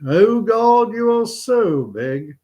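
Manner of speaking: high, drawn-out congregational response; schoolboys repeating prayer. Acted. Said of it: "And all the kids repeat".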